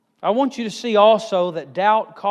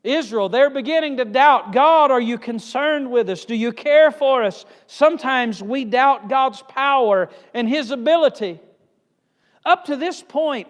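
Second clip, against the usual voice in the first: raised, louder preacher drive; full-force preaching; 6 semitones higher, 6 dB louder than usual. Acted. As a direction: preach it hard to the congregation.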